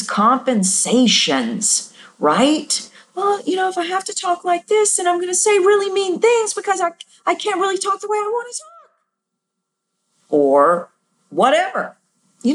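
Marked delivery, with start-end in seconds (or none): 3.1-8.9 high, put-on people-pleaser voice, speeding up; high, quick mock voice that isn't her own; higher pitched voice. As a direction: mimic a pleaser forced up into a high voice, faster toward the end. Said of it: "doesn't have to be a higher pitched sound".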